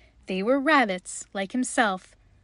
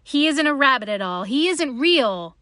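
raised, louder storybook accusation; higher, emphatic denouncement; exclaiming; 4 semitones higher, 6 dB louder than usual. A narrator's reading. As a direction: exclaim it as a damning discovery. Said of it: "he exclaimed".

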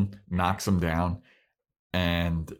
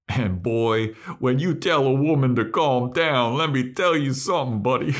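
louder, higher mock-warning; loud, higher comic lecture; comedic warning; 6 semitones higher, 5 dB louder than usual. Real, not acted. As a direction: wag a finger at the guys, loud and playful. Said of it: "In a comedic tone".

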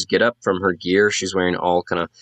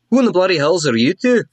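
high, indignant dwarf; affronted dwarven challenge; gruff dwarf woman; 8 semitones higher, faster than usual. Acted. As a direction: demand answers in a raised pitch. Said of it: "the dwarf who is shouting".

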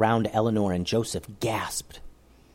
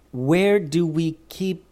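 confused; 6 semitones higher, slower than usual. A narrator's reading. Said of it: "obviously confused at this request".

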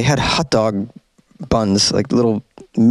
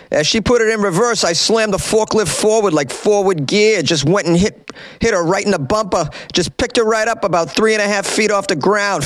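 higher, proud storyteller voice; hammed-up quote of Perlmutter; 7 semitones higher than usual. Host reading Perlmutter's boast, hammed-up proud. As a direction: brag it like a proud hero. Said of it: "Here's a quote from Perlmutter".

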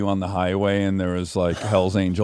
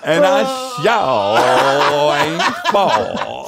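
mimicked uncle drawl, hugely stretched vowels; hammed-up slow Southern drawl; Uncle Tom; 4 semitones higher, 4 dB louder, much slower than usual. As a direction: crawl through it, high and drawn out. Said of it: "do his voice".

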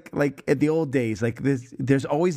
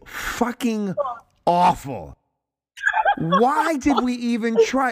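exasperated; 9 semitones higher, slower than usual. Real, not acted. Exasperated at bad accent.

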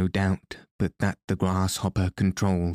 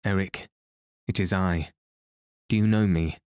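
a slightly raised call, not a shout. Acted. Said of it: "Then I raised my voice".

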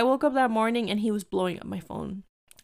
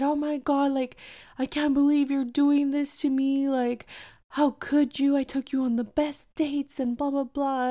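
high-pitched imitation of a tearful boyfriend; mock crying; 5 semitones higher than usual. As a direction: retell his tearful protest, pitched up. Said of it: "he started crying".

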